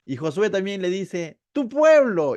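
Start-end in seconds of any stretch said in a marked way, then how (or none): 1.5-2.4 loud, high retort; passing the blame. Retold or imitated. Joshua handing the people back, loud reproach. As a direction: toss the blame back forcefully. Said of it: "comienzan a pelotearse al final".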